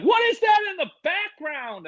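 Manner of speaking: high squeaky shout; mock shouting parents. Mocking the Zoom parents shouting a question, high and squeaky. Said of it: "People are like".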